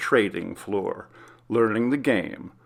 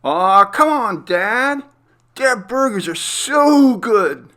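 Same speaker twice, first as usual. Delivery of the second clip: put-on much higher, louder pleading boy voice, drawn out; whiny kid; 12 semitones higher, 8 dB louder, slower than usual. Son begging his dad for burgers, high whiny plea. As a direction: beg like a kid wanting burgers. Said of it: "Bobby replied".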